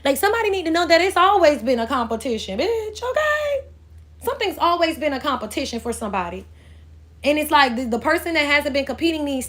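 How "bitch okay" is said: slow, high, stretched-out okay; drawn-out sassy okay. Real, not acted. Reader sassing the viewer, stretched high okay.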